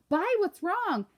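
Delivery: put-on high, loud worried kid's call; alarmed shout. Kenny finds his brother crying at the car, alarmed shout. Narrator voices alarmed kid calling out.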